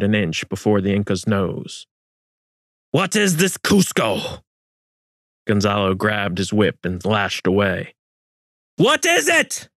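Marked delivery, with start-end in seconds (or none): 2.9-4.4 angry demand. A narrator's reading. Interrogation under torture. 8.8-9.7 furious demand. A narrator's reading. Gonzalo whipping the Inca, screaming for an answer.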